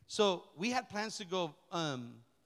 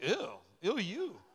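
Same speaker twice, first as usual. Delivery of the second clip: mocking ew back; slower than usual. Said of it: "and I'm like".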